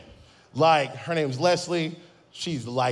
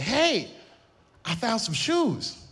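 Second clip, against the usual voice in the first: put-on higher, slow, sweet old-lady voice; mother's voice; 7 semitones higher, much slower than usual. Son mimics his mother telling him about new shoes, high and slow. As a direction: imitate mom gently announcing her find. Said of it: "my mother ... said".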